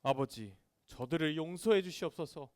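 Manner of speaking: low, subdued plea to God; acted prayer of Jesus, hushed a little; solemn prayer. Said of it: "이렇게 기도하셨어요".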